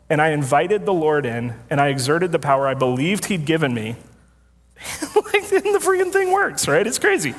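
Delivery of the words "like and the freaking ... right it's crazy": high, incredulous exclamation; can't believe it worked; amazed. Real, not acted. Telling the congregation the machine actually works, in disbelief.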